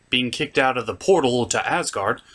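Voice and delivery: usual speaking voice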